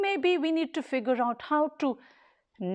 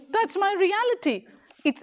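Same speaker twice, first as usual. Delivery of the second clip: ordinary talk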